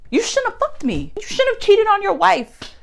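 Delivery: Diana voiced. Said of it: "Girl, she runs up on me".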